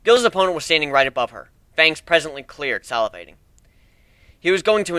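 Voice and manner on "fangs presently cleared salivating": plain reading voice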